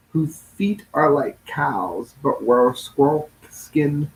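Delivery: ordinary delivery, nothing marked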